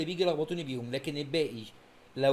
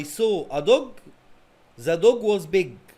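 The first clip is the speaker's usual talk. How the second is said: very slow, word by word, louder on the dog; slow dictation; 2 semitones higher, 7 dB louder, much slower than usual. Shown as a demonstration. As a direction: read it out slowly for the class. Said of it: "أعيد تاني عشان الناس متلخبطش". (قراءة بطيئة جدًا كلمة كلمة للطلبة)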